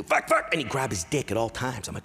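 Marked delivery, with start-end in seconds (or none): 0.0-0.5 imitated high, loud tic; Tourette's outburst. Imitating a classmate's Tourette's, sudden high yelp. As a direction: burst it out abruptly, high-pitched. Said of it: "That's all he would do".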